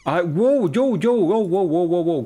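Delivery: high, loud frightened exclamations; panicked wows. Real, not acted. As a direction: blurt out in real panic. Sigue el susto. (exclamaciones de pánico)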